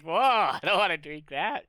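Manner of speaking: high, loud disgusted whoa; grossed-out whoa. Retold or imitated. The group reacting to a mustard milkshake, loud high disgust. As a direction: recoil in mock horror. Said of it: "everybody was like".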